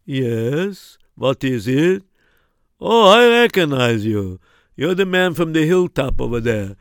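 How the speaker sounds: a friendly villager telling the stranger it is Pesach; townsman. Acted. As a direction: explain kindly that shops are shut. Townsman figure explains holiday.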